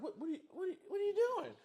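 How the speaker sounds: high, loud disbelieving squeal; shocked squeal. Retold or imitated. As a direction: shriek it in laughing disbelief. Shocked reaction, high laughing voice.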